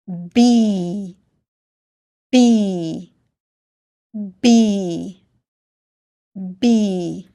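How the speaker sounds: B drawn out as "beee"; long vowel sound. Shown as a demonstration. Teacher reading a short word for learners, long vowel. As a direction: hold the long "ee" in B, letting it fall. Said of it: "will say the long sound E".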